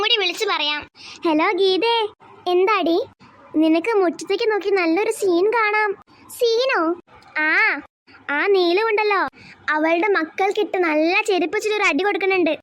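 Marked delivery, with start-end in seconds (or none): none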